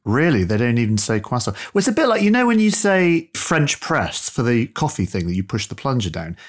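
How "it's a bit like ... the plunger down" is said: usual speaking voice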